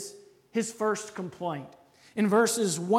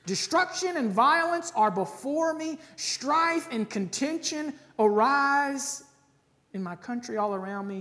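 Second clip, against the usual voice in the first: impassioned scripture reading; 6 semitones higher, 3 dB louder than usual. Acted. Preacher reads the prophet's cry to the congregation, impassioned. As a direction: read it as a desperate cry to heaven.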